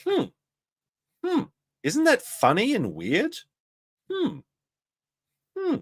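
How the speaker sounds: hum, then muse it slowly and high; Nick impression. Shown as a demonstration. Showing chat how to do Nick, slow high musing. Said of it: "If you wanna do a Nick impression".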